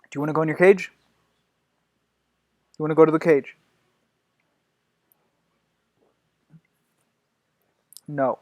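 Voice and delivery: loud stern warnings; loud scolding. Real, not acted. Owner scolding her dog with the cage threat, loud stern. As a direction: threaten the dog firmly and loudly.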